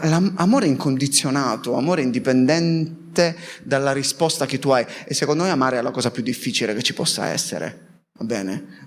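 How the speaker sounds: ordinary delivery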